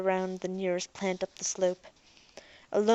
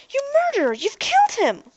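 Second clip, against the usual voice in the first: a much higher, louder shrieking accusation; acted scream; 6 dB louder than usual. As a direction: shriek it at the robot, outraged. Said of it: "Ariel screamed".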